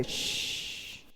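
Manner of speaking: unvoiced shushing hiss; hushing. Crowd hushing the blind beggar, unvoiced shush. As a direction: hush him sharply.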